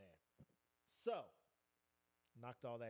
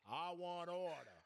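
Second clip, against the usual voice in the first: mock order call; 2 semitones higher than usual. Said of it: "mocking council member".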